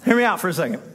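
ordinary delivery

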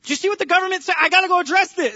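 high, loud, worked-up protest; preacher playing himself pleading with God; frantic rant. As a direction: rant like the world is on fire. Acted frantic self.

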